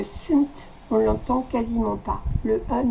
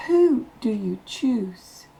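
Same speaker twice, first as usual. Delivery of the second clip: whispered "Who do you choose?". Shown as a demonstration. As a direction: breathe it out without voice. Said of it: "Le W ne se prononce pas". (chuchoté)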